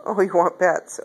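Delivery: ordinary delivery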